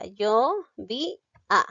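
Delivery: "Yo vi a" in three slow, separate syllables; syllabified. Shown as a demonstration. Teacher showing how to split the word, slowly syllabifying "Yo vi a". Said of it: "lo silabeo de esta manera".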